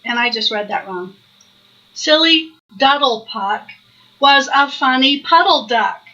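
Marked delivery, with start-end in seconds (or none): none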